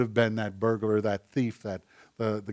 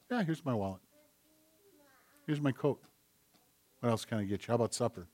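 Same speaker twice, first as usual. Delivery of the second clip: generous giver; 2 semitones lower, 8 dB quieter, faster than usual. Retold or imitated.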